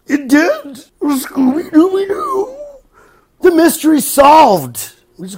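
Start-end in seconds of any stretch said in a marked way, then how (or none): 0.1-2.5 high, slow cartoon dog howl; Scooby-Doo impression. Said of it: "My voice just go up?". 3.4-4.9 mock cartoon reveal. Cartoon mystery parody.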